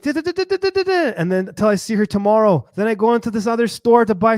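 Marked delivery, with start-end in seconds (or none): 0.0-1.1 high-pitched mock-Spanish babble; imitating Spanish-speaking woman. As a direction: rattle off nonsense syllables up high, as her. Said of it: "She's like".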